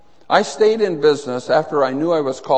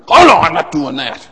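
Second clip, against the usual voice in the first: loud, stubborn mock refusal; 9 dB louder than usual. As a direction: dig your heels in, louder. Bank customer refusing to sign.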